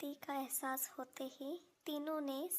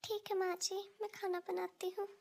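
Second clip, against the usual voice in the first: put-on higher, timid young wife's voice; meek daughter-in-law; 6 semitones higher than usual. Daughter-in-law timidly telling her mother-in-law she is sick. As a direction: plead weakly that she is unwell.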